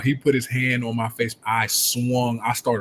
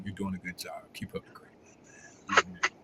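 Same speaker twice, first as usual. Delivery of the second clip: hushed, half-whispered praise; calm him down with a near-whisper; soft-spoken coach; 4 semitones lower, 13 dB quieter than usual. Retold or imitated. Coach quietly praising his fuming player, hushed and calm. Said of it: "and he was like".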